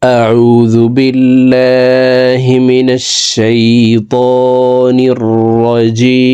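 slow, drawn-out tajweed chant; Quranic recitation. Shown as a demonstration. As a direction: recite it slowly with melodic elongation. (ধীর সুরেলা তিলাওয়াত)

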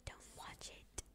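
faint whispered warning; whispering. Real, not acted. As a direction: whisper it like a secret. Secret warning to friend.

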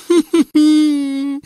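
very high, drawn-out hee-hee; screechy cackle. A narrator's reading. Governess screeching after the fleeing prince, shrieky cackle. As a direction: screech out a witchy wail. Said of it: "skrzeczała za nim Gburja Furia".

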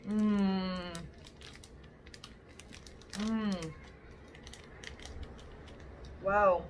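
humming while chewing; muffled through closed lips; mouth full, chewing. Real, not acted. Seen 1: Bites snack at -3; closed-mouth chewing with full cheeks throughout.

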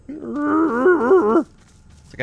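high, slow goofy whine; silly high voice. Acted. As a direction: drag it out in a silly squeaky voice. Mocking his own waddling run.

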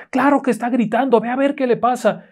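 high, urgent aunt voice; insistent aunt. Acted. Aunt urging her nephew to check on his sister, high and urgent. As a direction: push the boy to go look.

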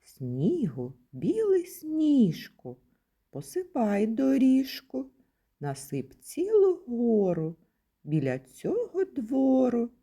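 reading a winter poem to preschoolers; children's poem. Shown as a demonstration. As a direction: recite the rhyme brightly for little ones. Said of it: "Пропоную послухати віршик".

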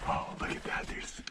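excited whisper, held down; hushed whisper. Real, not acted. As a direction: whisper it like a secret find. Whispering at discovered ore chutes.

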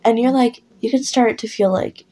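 ordinary delivery

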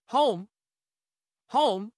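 loud calling. Acted. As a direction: call out to the house. Calling louder to the house.

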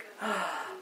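quiet, thrilled little gasp; playful gasp. Pretend. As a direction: gasp softly as if impressed. Mock thrill at exotic boyfriend.

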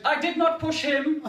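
put-on high, eager kid voice protesting innocence; child denying. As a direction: chirp it like a guilty little kid.

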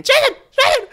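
high screaming call of a name; frantic mom yelling up the stairs; retold scream. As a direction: shout the name like a panicked mom. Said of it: "my mom comes running into my room screaming".

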